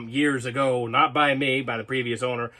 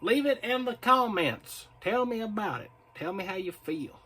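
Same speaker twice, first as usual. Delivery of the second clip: Southern accent; 5 semitones higher, 3 dB quieter than usual. Shown as a demonstration. Vlogger asking viewers for comments, sliding into Southern accent. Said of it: "I don't know why I slipped into Southern".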